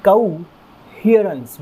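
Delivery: कोहेरेंस drawn out slowly, each sound clear; slow model word. Shown as a demonstration. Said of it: "ध्यान रखिएगा".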